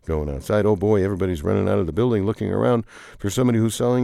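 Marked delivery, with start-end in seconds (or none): none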